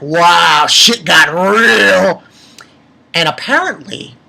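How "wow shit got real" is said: loud, drawn-out retold amazement; big hammed-up shock; stunned reaction. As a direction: blurt it loudly, stretching the last words. Retells own shocked reaction.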